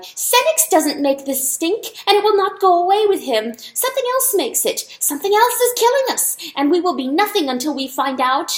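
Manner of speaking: high, loud frantic mouse cry; squeaky mouse cry to the animals. A narrator's reading. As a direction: shout it out, desperate to be heard. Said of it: "the mouse cried".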